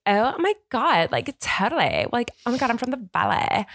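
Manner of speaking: high-pitched mock valley girl. Mocking fake Californians from the valley, valley girl accent. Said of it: "if you ever hear a girl going like".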